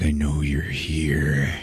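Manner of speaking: slow, low menacing whisper. Retold or imitated. Cop searching the club for the killer, slow whisper. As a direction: breathe it out slowly, no voice. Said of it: "he's like".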